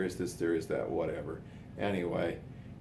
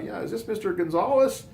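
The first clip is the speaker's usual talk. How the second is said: high, loud put-on official voice; prank caller voice; 11 semitones higher, 5 dB louder than usual. Prank-calling the brother-in-law, high put-on fire chief voice. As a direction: disguise your voice as a fire chief. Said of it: "Chief Fredrickson of the Ogden Fire Department".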